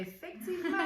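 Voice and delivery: high-pitched, laughing outburst; amused exclamation. Real, not acted. Joking reply about the last item.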